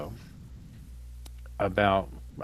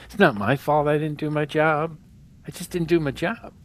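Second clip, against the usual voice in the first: Mike Tyson impression; 5 semitones higher, 4 dB louder than usual. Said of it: "Are you doing your Mike Tyson impression?".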